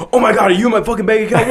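fast, high-pitched mock panic; freaking out. Man to the hacker about his bank account, freaking out. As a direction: imitate a man rushing out a panicked question. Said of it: "he's freaking out".

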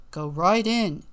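loud guard voice. Acted. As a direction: wave them in as the guard. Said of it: "said the guard".

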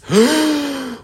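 sharp high gasp of fright. Acted. Storyteller reacting to the Carnotaurus, a high frightened gasp. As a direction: gasp like you just saw it.

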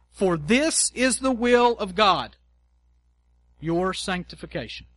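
slow solemn reading, will of God stressed; scripture reading. Acted. Preacher reading First Thessalonians to the congregation, solemn and slow. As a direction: read the verse with weight.

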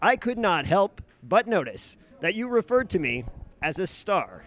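syllables landing at uneven times; self-important character delivery with no real conviction; weird stilted tone. Acted. The Professional addresses the host about being called a star, weird stilted tone. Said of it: "that weird tone where you pronounce all the different syllables at different times".

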